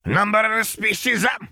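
high, far-off squeaky alien waitress; temperamental waitress. Acted. Tiny alien waitress barking at newcomers, high and squeaky. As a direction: snap at him like a tiny impatient creature.

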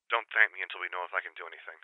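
quick, soft, distant telephone reply; voice over the phone. Acted. Sister on the phone refusing thanks, soft and quick. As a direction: hurry it out softly from the other end.

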